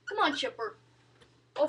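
usual speaking voice

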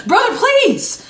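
high, slow exasperated please. Real, not acted. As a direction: drag it out in disbelief. Exasperated dismissal.

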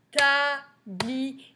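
Tablier sing-song in three separate beats, last held; drawn-out syllable count. Shown as a demonstration. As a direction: chant TA, BLI, ER with a long ending. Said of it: "dans le troisième".

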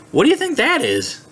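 ordinary delivery, nothing marked

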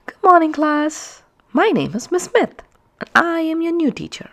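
lightly voiced friendly teacher; bright teacher voice. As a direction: greet the class like a cheerful new teacher. Teacher character greets class.

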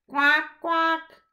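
loud quacking; duck quack. Acted. Narrator quacking like ducks gathering, loud. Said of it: "Here are some ducks saying".

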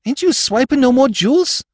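high, loud disbelieving question; astonished New York crook; incredulous. Acted. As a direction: ask it aghast, pitch way up. Crook stunned by boss's retirement.

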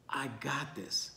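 slow, very soft and calm; quiet reassurance. Acted. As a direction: settle their worries with hushed assurance. Jesus reassuring those in trouble.

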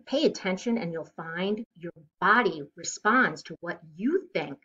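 usual speaking voice

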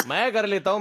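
ordinary delivery

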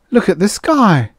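high, loud exclamation; urgent call. Acted. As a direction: call them to look up. Calling others to see sky.

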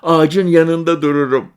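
put-on high, loud delighted boy; a kid's delighted yes; child voice. Boy delighted by the promised photo, high and loud. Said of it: "dedi çocuk".

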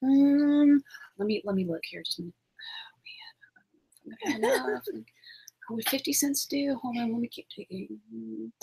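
very soft, half-whispered fumbling; dithering customer. Acted. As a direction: mumble to yourself while hunting for change. Acting a dithering customer fumbling for change.

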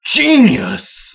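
gleeful exclamation. Acted. Colleague cheering the cameras-in-cameras plan, thrilled. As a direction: explode with glee.

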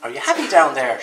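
loud call to someone below; shouted. A narrator's reading. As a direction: shout the question down from the tree. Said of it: "shouted Bear".

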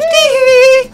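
high squealed tee hee. Retold or imitated. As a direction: yelp it like the famous falsetto. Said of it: "the guy that goes".

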